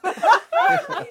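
high, loud laughing attempt; helpless giggles breaking into the words. Real, not acted. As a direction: say the name through real laughter. Cracking up at silly name.

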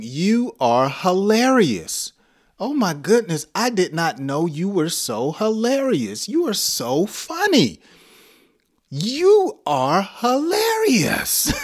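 high, drawn-out delighted praise; gushing amusement. Acted. Teacher acts out praising a hilarious friend, gushing amusement.

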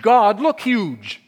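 slow, loud, high stretch on huge; drawn-out emphasis. Real, not acted. Preacher stretching God look huge. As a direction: stretch the words out big and loud. Preacher magnifying God's size.

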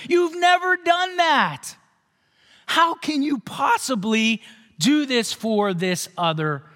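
high, indignant protest at full voice; the wronged loyal son complaining to his father; angry older son. Retold or imitated. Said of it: "the older son was so angry".

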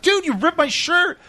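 high-pitched mock protest; acted-out squawk of protest; indignant contestant. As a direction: complain like a wronged game show contestant. Acts out upset contestant.

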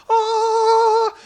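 hammed-up high, loud "Ah"; a showy healer's fake power cry; mock power cry. Pastor mocking how Jesus did not act, with a mock power cry.

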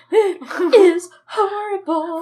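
high, loud, drawn-out fake sobs; hammed-up bawling through laughter; mock wailing. Host clowning as their crying self, mock wail. Jokingly replaying the medicated crying.

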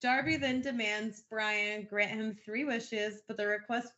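ordinary talk, nothing marked

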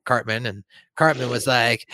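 ordinary delivery

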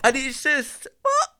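laughing disbelief. Real, not acted. Disbelief at the translation.

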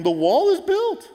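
loud, very high triumphant cry; Nehemiah. Acted. Preacher voicing Nehemiah declaring the job done, exultant shout. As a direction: proclaim it at the top of your range.

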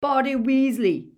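high, loud, thick-tongued blurt; stuffed-up consonants, urgent and pitched high; mouth full of blood. A narrator's reading. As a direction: force the name through a bleeding mouth. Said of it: "his mouth was full of blood".

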